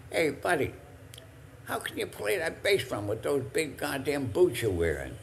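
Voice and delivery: a friend's playful jab; teasing call-out. Retold or imitated. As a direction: poke fun at the big boots. Armin teasing Buddy on stage.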